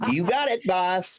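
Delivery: cheerful eager reply. Acted. Player answers boss cheerfully.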